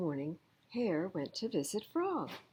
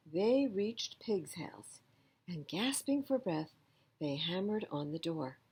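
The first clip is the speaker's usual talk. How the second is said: ordinary delivery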